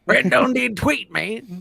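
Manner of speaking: high slow Southern drawl, Boomhauer style; Southern accent. Retold or imitated. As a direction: drawl it out like a Texas good ol' boy. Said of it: "a little bit of a boomhauer that you just threw out there".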